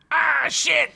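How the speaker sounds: high startled goblin cry; panicked goblin shout; goblin yell. Acted. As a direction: yelp as stuff crashes down. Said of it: "Skunk yelled".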